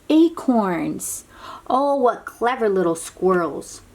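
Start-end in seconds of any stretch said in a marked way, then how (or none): none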